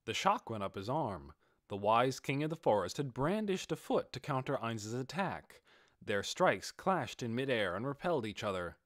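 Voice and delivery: ordinary delivery, nothing marked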